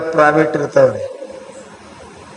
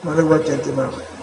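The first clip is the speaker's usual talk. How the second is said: quiet repeat; 2 semitones lower, 6 dB quieter than usual. Real, not acted. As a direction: drop the volume and let the advice settle. Same rule repeated softly after proclamation.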